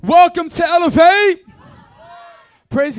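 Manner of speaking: high, booming shout to the crowd; hyped welcome shout. Real, not acted. Preacher welcoming the congregation, hyped shout. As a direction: shout a big welcome to the room.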